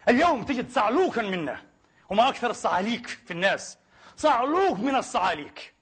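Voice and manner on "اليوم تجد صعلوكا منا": ordinary talk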